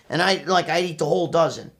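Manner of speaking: ordinary talk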